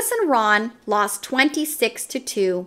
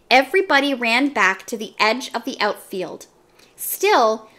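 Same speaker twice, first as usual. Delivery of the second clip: ordinary delivery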